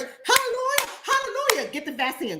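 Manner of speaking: falsetto hallelujah, very high then dropping; churchy mock praise. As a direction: mimic a shouting church lady, sky-high. Mocking churchgoers shouting praise.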